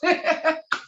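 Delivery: bright giggle of joy; happy laugh. Real, not acted. Guest laughing at her own date slip. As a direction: laugh out with delight.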